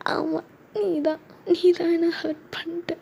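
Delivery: high, soft, slow tearful accusation; crying. Acted. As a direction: sob out the blame. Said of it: "ஏன் அழுகுற?".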